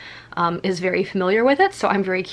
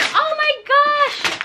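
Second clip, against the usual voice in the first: much higher, stretched oh my gosh; thrilled surprise; 3 dB louder, much slower than usual. Real, not acted. Finding a Disney puzzle in the package, delighted high squeal. Opening the final gift.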